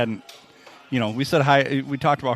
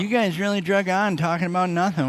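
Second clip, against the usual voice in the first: imitating a griping listener, pitched up; put-on listener gripe; mock complaint; 6 semitones higher than usual. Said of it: "where people start to complain".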